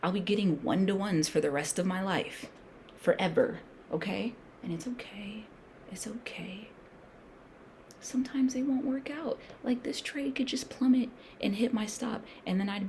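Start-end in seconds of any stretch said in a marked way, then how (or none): 4.6-6.7 reassuring whisper. Real, not acted. Calming talk on losing trades.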